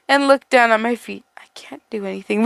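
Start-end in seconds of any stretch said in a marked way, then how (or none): 1.4-2.4 quick breathy whisper, faster. Acted. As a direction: rush it out in a whisper.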